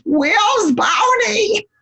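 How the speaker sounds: put-on high slow whining child voice; high whine of a toddler wanting her show; whiny kid. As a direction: draw it out like a bored little kid. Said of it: "these two kids on the couch".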